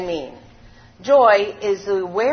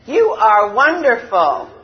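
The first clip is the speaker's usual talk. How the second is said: echo; 3 semitones higher, 7 dB louder, slower than usual. A narrator's reading. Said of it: "And the voice said".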